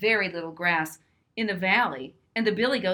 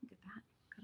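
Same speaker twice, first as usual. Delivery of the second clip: whisper; 27 dB quieter than usual. Real, not acted. Aside about a picture.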